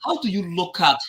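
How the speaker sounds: ordinary delivery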